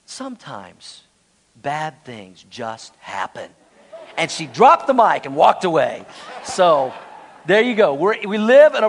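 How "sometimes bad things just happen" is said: very soft, slow, low and gentle; sweet and innocent wife. Retold or imitated. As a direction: hush it out like a sweet wife calming her husband. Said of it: "just sweet and innocent".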